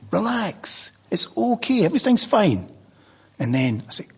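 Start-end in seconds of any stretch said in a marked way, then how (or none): 0.1-2.7 soothing. Retold or imitated. Soothing his dead mother.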